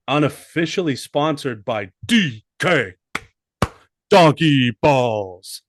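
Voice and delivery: slow, loud sponsor tag on Donkey Balls; drawn-out announcer plug. Acted. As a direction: sell it like a radio ad. Mock sponsor read.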